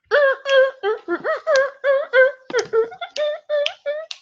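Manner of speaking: long, very high-pitched giggle; helpless squeaky laughter; real giggling fit. Real, not acted. Reader cracks up at the silly story line, real giggling fit.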